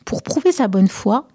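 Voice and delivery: usual speaking voice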